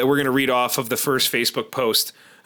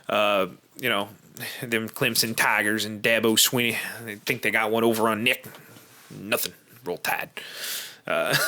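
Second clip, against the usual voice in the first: Southern accent; 5 semitones lower, 4 dB quieter than usual. Shown as a demonstration. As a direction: grumble it like a sore Bama fan. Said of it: "thought about starting this podcast in a Southern accent".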